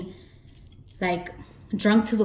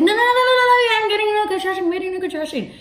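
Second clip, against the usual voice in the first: very high, fast, strained pleading; pained whine; 14 semitones higher, 6 dB louder, much faster than usual. Retold or imitated. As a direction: squeal it out fast in pain. Contraction during epidural.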